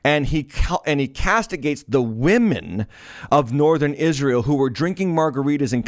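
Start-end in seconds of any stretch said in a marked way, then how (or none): none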